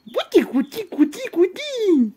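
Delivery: high playful tickling coo; tickle coo. Real, not acted. Owner tickling her pet with high playful coos.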